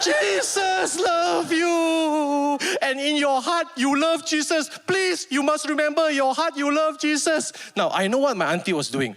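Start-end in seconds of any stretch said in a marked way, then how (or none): 0.0-7.5 much higher, slow, wailing imitation of the aunt; hammed-up sobbing plea to the dying grandma. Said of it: "She started bawling her eyes out".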